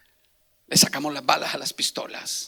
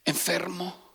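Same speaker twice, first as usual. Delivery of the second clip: ordinary talk, nothing marked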